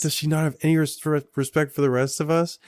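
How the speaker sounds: high, fast, stammering mock outrage; mock indignant. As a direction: sputter it in fake outrage. Repeats quote, stammering.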